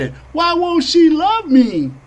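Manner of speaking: high, slow mock whine; mock whining. Mocking voter whining high and slow. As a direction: whimper it like a pitiful jilted lover.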